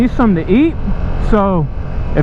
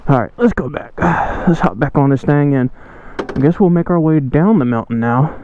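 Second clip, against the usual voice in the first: ordinary delivery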